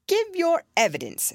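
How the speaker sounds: a pompous king giving orders. Acted. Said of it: "the king repeated".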